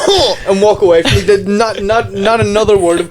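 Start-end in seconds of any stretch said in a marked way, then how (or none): 0.0-0.4 short high "huh" in imitation; mock dismissive huh; imitated huffy grunt. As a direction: huff it high, as the snubbing roommate. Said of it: "she would just go".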